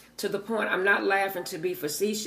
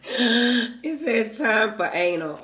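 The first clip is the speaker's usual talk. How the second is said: laughing; 3 semitones higher, 4 dB louder, slower than usual. Real, not acted. Reading card aloud, laughing.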